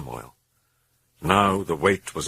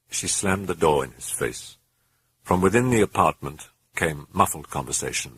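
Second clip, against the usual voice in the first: plain reading voice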